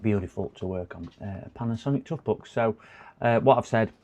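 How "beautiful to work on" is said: Beautiful said low and much softer, dry; sarcastic mutter. Acted. Repairer grumbling to himself about the laptop, quiet sarcasm. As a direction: drop it to a quiet ironic aside.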